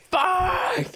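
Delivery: high, mock cry of pain; Ash. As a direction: curse through pretend agony. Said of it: "is just in pain".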